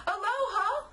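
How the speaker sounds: bright, high aloha called out; cheery farewell. Acted. Santa calling goodbye as he sails away. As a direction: sing out a breezy goodbye.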